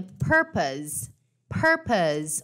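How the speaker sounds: "purpose" ending in a z sound, low pitch. Shown as a demonstration. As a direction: stress PUR, end "purpose" with a buzzing Z. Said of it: "olha a pronúncia dela".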